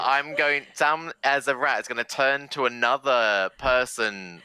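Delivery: ordinary delivery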